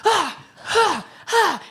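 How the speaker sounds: mock sore-loser grunts; imitating frustrated groans. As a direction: ham up his losing-it groans. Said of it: "he's losing it".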